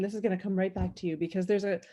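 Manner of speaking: ordinary talk, nothing marked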